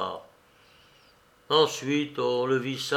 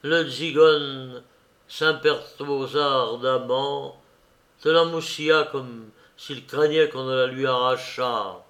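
usual speaking voice

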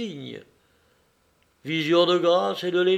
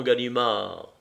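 usual speaking voice

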